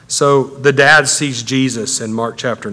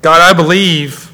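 raised voice climbing steadily higher; anguished pleading; 5 semitones higher, 4 dB louder than usual. Acted. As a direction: pour out your troubles to God, building in urgency. Acts father pouring out to God.

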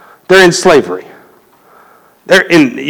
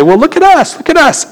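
much higher mock voice of his wife; imitating wife; 9 semitones higher than usual. Wife answering his complaint, in a much higher mock imitation. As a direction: pitch it way up, teasing, pointing at the family. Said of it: "you'll say".

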